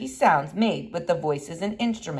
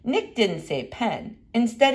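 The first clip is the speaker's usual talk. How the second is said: ordinary delivery, nothing marked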